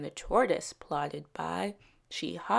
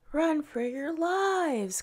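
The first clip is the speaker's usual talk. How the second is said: much higher, slow frantic cry; panicked call; 10 semitones higher, slower than usual. A narrator's reading. Townsfolk fleeing the dragonfly, higher slow panicked call. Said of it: "called the people of Pewee".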